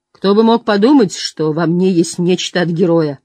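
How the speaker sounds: ordinary delivery, nothing marked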